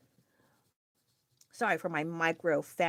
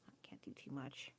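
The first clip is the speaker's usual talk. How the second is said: a soft whisper to herself; hushed aside; 5 semitones lower, 22 dB quieter than usual. Real, not acted. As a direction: whisper it under her breath.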